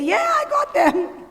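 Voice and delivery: high-pitched, drawn-out triumphant cry with laughter; gleeful mock cheer. Host imitating children playing hide and seek, gleeful cheer. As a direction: crow it like a kid winning a game.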